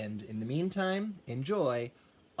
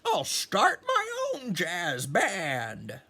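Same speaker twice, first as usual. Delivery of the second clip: much higher, fast rooster voice; excited rooster; 6 semitones higher than usual. Acted. Rooster hit by a great idea, high excited voice. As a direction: pop the idea out like a cocky rooster. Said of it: "he said".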